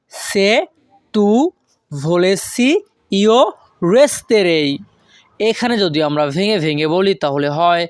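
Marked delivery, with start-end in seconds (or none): 0.1-4.7 word by word. Shown as a demonstration. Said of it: "এর Italian ভাষা হবে".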